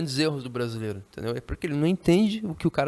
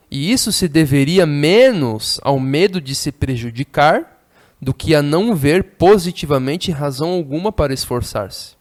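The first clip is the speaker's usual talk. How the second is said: reading a novel aloud; 9 dB louder than usual. Acted. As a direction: declaim the passage to the class.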